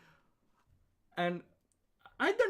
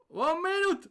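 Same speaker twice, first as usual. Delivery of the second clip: high, loud barked countdown; loud soldier shout; 13 semitones higher, 5 dB louder than usual. Acted. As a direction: yell it to the whole squad.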